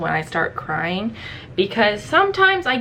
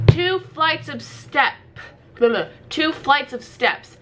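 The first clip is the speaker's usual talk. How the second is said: exasperated repeat; 6 semitones higher than usual. Retold or imitated. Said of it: "exasperated repeat".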